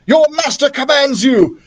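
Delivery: villain's command. A narrator's reading. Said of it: "cried Ignatius".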